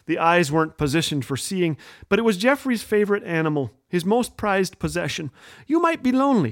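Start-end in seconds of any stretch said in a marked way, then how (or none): 5.7-6.5 put-on higher, earnest small-child voice; little boy's worry. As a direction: say it like a caring four-year-old. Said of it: "he said".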